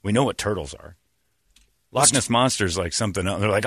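usual speaking voice

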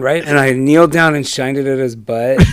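ordinary talk, nothing marked

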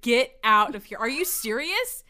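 loud, incredulous retold telling-off; retold exasperation. Friend got mad at the insecure question, retold exasperation. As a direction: dismiss the question loudly, exasperated.

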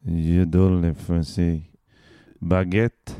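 slow, low French; French accent. Acted. Demonstrating number seven, low French accent. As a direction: put on a stock French accent. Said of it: "Number seven is French".

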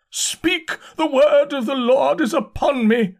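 high, slow, frenzied religious cry; full-voiced shout. A narrator's reading. Curate declaring the Lord's word is upon him, frenzied shout. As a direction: proclaim it at the top of your voice. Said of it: "shouted the curate at the top of his voice".

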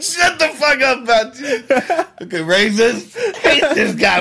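loud, high, laughing retort; laughing mock outburst. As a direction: shout it in mock outrage through laughter.

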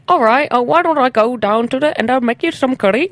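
high mock accent sliding from English into Indian; Australian accent slipping. Shown as a demonstration. Showing how Australian attempts fail, accent sliding into Indian. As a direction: let a bad Australian accent drift Indian. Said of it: "You either fall into English or, like, Indian".

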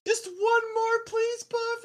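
very high mock girly voice; hammed-up, high-pitched female pleading; pleading girl. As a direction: beg like a smitten groupie. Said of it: "the girl's like".

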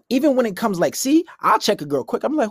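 ordinary talk

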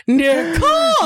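high, long mock wail; horrified self-scolding. Comedian realising the blunder at home, hammed-up horrified cry. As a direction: cry out your own name in dismay. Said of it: "I was like".

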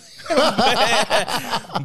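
loud, high cackle; big high-pitched belly laugh; real burst of laughter. Real, not acted. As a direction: laugh uncontrollably. Laughing after mock scolding listener.

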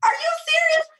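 very high, loud disbelief; squealed, astonished challenge; incredulous outburst. Real, not acted. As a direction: cry out in exasperated amazement. Astonished that friend barely hears it.

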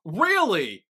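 high, loud and taken aback; real disbelief. Real, not acted. Host to co-host who dislikes Ben, incredulous. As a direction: halt everything in genuine surprise.

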